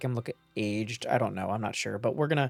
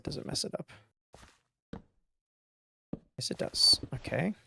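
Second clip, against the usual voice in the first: hushed whisper to himself; 5 dB quieter than usual. Real, not acted. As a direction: whisper under his breath while testing. Whispered muttering while testing.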